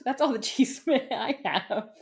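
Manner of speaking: giggling sign-off; laughing. Real, not acted. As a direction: try to finish through a laugh. Cracks up while wrapping segment.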